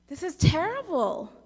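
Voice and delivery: narrated shout, pushed up in pitch and volume; storyteller's shout. A narrator's reading. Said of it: "a woman who was building a campfire shouted".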